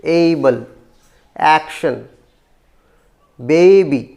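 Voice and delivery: एबल, action, baby each with a strong first syllable; teacher's demo, first beat prominent in एबल, action, baby; first-syllable stress. Shown as a demonstration. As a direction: punch the opening of एबल, action, baby and let the ending fall. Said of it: "first syllable पे stress है".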